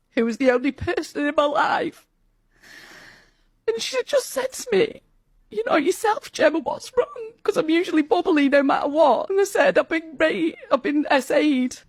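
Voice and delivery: barely holding back tears while telling it; voice breaking. Real, not acted. Telling her mum, voice breaks.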